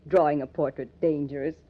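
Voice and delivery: ordinary talk, nothing marked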